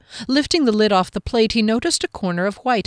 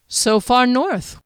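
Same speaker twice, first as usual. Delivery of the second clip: slow, higher-pitched astonishment; surprised exclamation; 6 semitones higher, 3 dB louder, slower than usual. A narrator's reading. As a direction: exclaim it, drawing the words out. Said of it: "he'd exclaimed".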